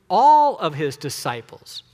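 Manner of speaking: ALL drawn out and loud; stress on ALL. Real, not acted. Preacher insists nobody is left out, stressing ALL. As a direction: lean long and hard on "all". Contrast with just a subset.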